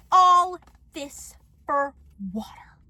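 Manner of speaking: word by word, with long pauses; slow aghast repeat. Acted. Vlogger repeating the neighbor's note in disbelief, slow and aghast. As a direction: start loud, then let each word drop away.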